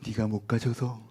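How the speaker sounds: slow, hushed grumble; envious whisper. Acted. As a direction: whisper the grievance, drawing it out.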